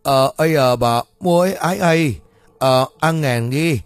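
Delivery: loud, halting garbled speech; stuttering spirit medium. Acted. Medium telling how to save the boy, stuttering loudly. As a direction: struggle noisily through mangled syllables. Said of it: "bị cả lăm từ nhỏ".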